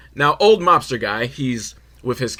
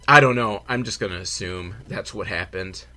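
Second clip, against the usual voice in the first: ordinary delivery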